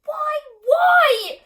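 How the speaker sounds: loud high anguished wail; crying out. A narrator's reading. Distraught mother curling up, wailing loudly. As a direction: wail it out in despair. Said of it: "she cries".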